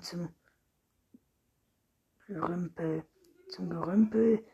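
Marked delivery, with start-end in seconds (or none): 3.5-4.4 louder, higher indignant echo. A narrator's reading. Rüdiger objects to his coffin among junk, indignant. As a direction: throw the phrase back, outraged. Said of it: "rief Rüdiger empört".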